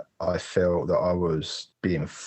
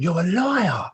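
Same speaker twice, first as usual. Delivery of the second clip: angry accusation; 9 semitones higher, slower than usual. Retold or imitated. Accuses mum's friend on phone.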